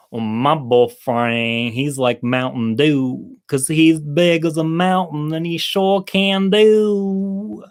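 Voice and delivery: put-on slow, drawn-out girl voice. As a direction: stretch it out, suggestive. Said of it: "the second girl says".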